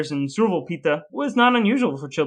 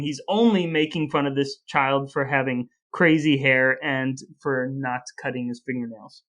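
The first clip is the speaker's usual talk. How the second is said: ordinary delivery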